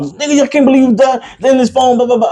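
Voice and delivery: acted-out high-pitched scolding rant; worked-up dad yelling. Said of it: "I start up here".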